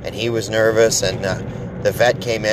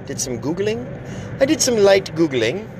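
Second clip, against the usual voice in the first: high, sing-song Googling; mock innocent, voice up high; lightly put-on silly voice; 9 semitones higher than usual. Self-mocking aside.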